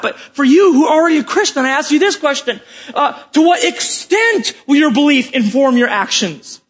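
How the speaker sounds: high-pitched, emphatic pulpit challenge; forceful preaching. Real, not acted. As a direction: challenge the congregation with rising intensity. Preaches with raised voice.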